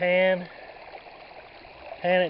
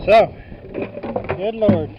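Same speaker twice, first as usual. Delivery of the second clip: loud exclamation; 4 semitones higher, 8 dB louder, slower than usual. Real, not acted. Wind picking up, raised voice.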